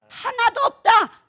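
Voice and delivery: high, loud and drawn out; emphatic declaration. Real, not acted. Preacher drives scripture home to congregation, emphatic declaration. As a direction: ring it out as an absolute verdict.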